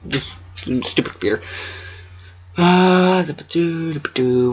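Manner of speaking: plain reading voice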